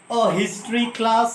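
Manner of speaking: a history class, slow with a sounded h; slow demo. Shown as a demonstration. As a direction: say it slowly, sounding the h. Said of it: "long form में पढ़ोगे". (धीरे, ह की आवाज़ के साथ)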